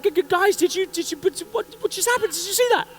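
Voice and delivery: high, loud stuttering panic; stammering shock. Retold or imitated. Stunned first-timer asks his instructors, high panicked stammer. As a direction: babble in disbelief. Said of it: "I'm like".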